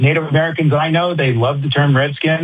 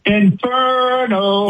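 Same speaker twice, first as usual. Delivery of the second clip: pitched-up, stretched Inferno; pitched-up hyped Inferno; higher, more energy; 9 semitones higher, much slower than usual. Acted. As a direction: give it more guts. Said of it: "say Inferno with more balls".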